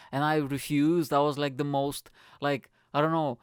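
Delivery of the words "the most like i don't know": usual speaking voice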